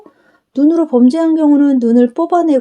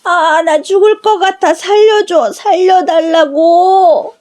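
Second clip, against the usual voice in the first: much higher, frantic wailing plea, drawn out slowly at the end; tormented soul crying out to be saved, much higher; desperate scream for help; 7 semitones higher, 5 dB louder than usual. Acted. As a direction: beg for your life from the fire of hell. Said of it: "발악을 하면서 소리를 질러댔습니다".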